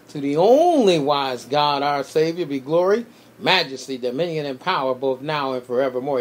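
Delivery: rising, emphatic recitation of a blessing; preached benediction. Real, not acted. Pastor closing the broadcast with a blessing, proclaimed with force. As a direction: proclaim the benediction, swelling on the key phrases.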